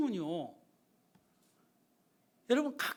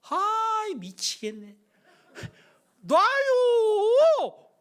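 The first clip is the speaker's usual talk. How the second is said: high, loud stretched-out wail; exasperated whine; 10 semitones higher, 9 dB louder, much slower than usual. Retold or imitated. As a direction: whine out the last word endlessly. Son whining to be released. (높고 길게 늘이는 짜증 섞인 외침)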